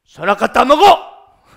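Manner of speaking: loud, forceful shouted order; aggressive command. Acted. Preacher shows how Satan did not tempt Eve, coercive shout. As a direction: bellow it as a bullying demand. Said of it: "공격적으로, 강압적으로".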